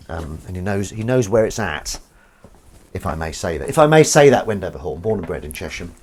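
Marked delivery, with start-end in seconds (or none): 3.7-4.5 higher and much louder; emphatic repeat. Real, not acted. Host praising a local reporter, emphatic and loud. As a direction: proclaim it grandly.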